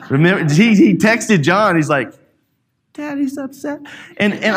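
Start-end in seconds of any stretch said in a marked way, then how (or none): 2.9-3.8 put-on small, high, soft kid voice; retold upset child. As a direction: imitate a child quietly telling on dad, pitched way up. Retold son texting about dad.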